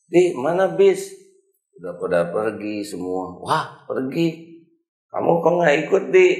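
startled that the bus has gone; a friend asking the boy why he stayed; surprised friend. Acted. Friend asks where the bus went.

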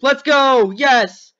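loud, high cheer; real thrilled shouting; excited shout. Real, not acted. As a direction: yell it out as the ride takes off. Cheering on the loop-de-loop.

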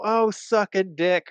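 high, drawn-out mock taunt; angry retort. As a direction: toss back a slow, high-pitched insult. Insult thrown in road quarrel.

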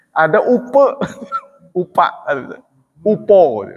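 "upe", "upa", "upo" each stressed, the final vowel changed; vowel variants. Shown as a demonstration. Listing rice words to show how hard Javanese is, in a demo. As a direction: spell out the three vowel endings. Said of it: "mumet gitu".

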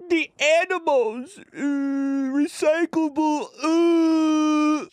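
mock wail in a very high, stretched voice; imitating wife. Husband imitating his wife fretting about animals, high mock wail. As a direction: whine it out high and slow, like mock crying. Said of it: "My wife does this".

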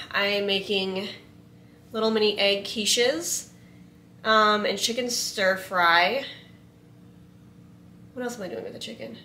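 ordinary talk, nothing marked

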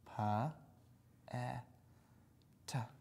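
segmenting each sound slowly. Shown as a demonstration. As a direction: sound out each letter separately. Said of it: "Spell pet".

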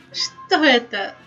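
ordinary talk

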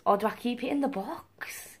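unvoiced whispered aside; conspiratorial whisper. Real, not acted. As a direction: whisper it like a secret to yourself. Secret aside to self.

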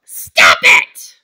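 loud, harsh command; exasperated shout. Acted. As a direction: shout to stop a pupil. Teacher struggles with refusing pupil.

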